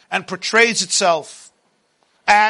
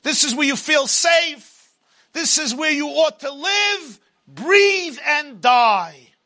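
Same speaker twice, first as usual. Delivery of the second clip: higher, slow, taunting Pharaoh voice; Pharaoh's voice; 4 semitones higher than usual. Acted. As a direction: loom over them as a tempting tyrant. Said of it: "Paro is there ... and saying".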